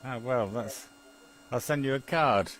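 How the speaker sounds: ordinary talk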